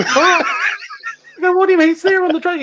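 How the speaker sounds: very high, loud disbelief; incredulous panic. Retold or imitated. Musician told the bandmate is missing, incredulous. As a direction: act out alarmed confusion.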